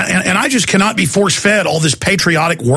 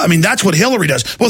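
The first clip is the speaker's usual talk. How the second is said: ordinary talk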